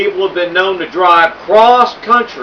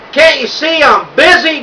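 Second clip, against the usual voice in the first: higher, snapping shout; impatient shout; 7 semitones higher than usual. A narrator's reading. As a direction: yell it, annoyed at the interruption. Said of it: "Duke shouted".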